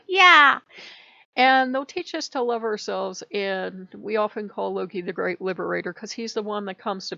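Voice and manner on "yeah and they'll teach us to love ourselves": sudden high loud yell; excited shout. Retold or imitated. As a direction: burst out with a big yeah. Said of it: "I go".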